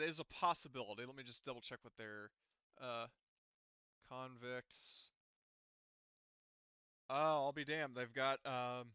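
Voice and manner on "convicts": ordinary talk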